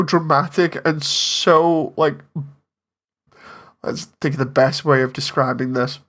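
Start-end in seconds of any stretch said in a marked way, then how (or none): none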